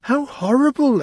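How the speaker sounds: high, loud cry of horror; shocked exclamation; exclaiming. A narrator's reading. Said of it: "exclaimed Alice".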